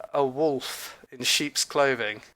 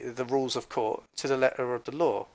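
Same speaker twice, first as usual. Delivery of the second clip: normal talking voice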